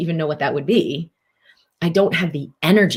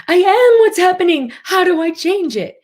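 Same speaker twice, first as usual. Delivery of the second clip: much higher, louder, frantic; panicked reactive self; 12 semitones higher, 6 dB louder than usual. Pretend. Coach acting the reactive self to viewers, frantic and high. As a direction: play the self swallowed by the problem.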